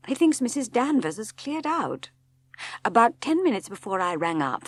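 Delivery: a hint of a worried husband reporting news; lightly voiced Maxim, tense. A narrator's reading. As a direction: Maxim uneasy, pressing on. Maxim's voice in the story.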